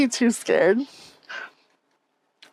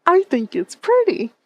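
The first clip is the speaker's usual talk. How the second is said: high, slow, sweet voice; cutesy simple voice; 8 semitones higher, 3 dB louder than usual. Retold or imitated. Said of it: "It can just be like".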